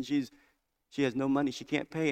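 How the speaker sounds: ordinary talk, nothing marked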